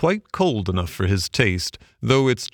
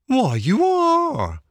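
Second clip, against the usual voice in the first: high, drawn-out shocked barge woman; astonished cry; much slower than usual. A narrator's reading. Barge woman discovering he is a Toad, high astonished cry. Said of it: "she cried".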